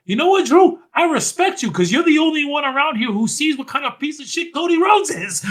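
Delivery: hammed-up impression, pitched far above own voice; Kevin Owens. Kevin Owens gets in Drew's face, high emphatic mock rant. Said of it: "and be like".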